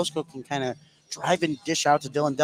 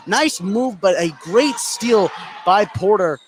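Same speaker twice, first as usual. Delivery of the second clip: louder, higher shout on the steal; excited call; 5 semitones higher, 6 dB louder, slower than usual. Real, not acted. Caller reacting to a sudden steal, shouting. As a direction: erupt as the ball gets stolen.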